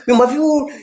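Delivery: high, loud mock Gullah insult; Gullah accent. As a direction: toss off the line in Gullah. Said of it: "And it comes out like".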